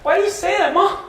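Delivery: put-on high-pitched childish whine to mom; whining sister. Grown sister complaining to Mom about teasing, high whiny wail. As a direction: whine it like a tattling little girl. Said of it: "she says now at thirty-five years old".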